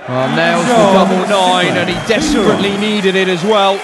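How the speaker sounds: thrilled commentator; excited commentary. Real, not acted. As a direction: lift the voice with the big moment. Commentator reacting to key double.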